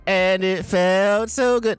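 high, slow, blissful; dreamy drawn-out glee. Retold or imitated. Voicing elated hero.

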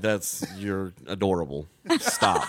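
slow, laughing, amused; laughing brush-off. Retold or imitated. Bartender to heckler, laughing dismissively. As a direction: tease him like he's cute.

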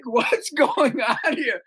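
slow, high, puzzled; drawn out in mock confusion; father puzzled by the angry man; bewildered question. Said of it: "now I'm like".

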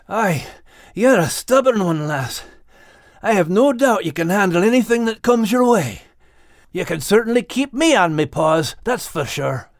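a hint of a Scottish-sounding dog; gruff-ish friendly dog, teasing; lightly voiced Max. A narrator's reading. Max teasing Kate after the race, lightly voiced.

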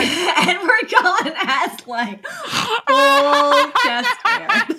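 high squealing laugh through words; words breaking into high laughter. Real, not acted. Gushing over Edward's chest hair.

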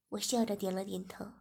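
plain reading voice

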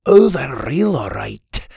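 lightly voiced fisherman, emphatic opening; local fisherman. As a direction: play a knowing fisherman, punching the first words. Voices the old fisherman figure.

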